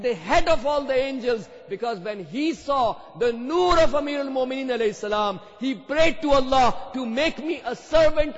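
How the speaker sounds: loud, high, impassioned sermon voice; fiery preaching. Acted. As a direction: preach it out to the crowd. Preaching at full force.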